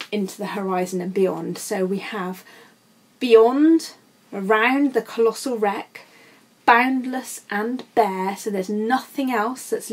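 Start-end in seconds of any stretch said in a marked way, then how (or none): none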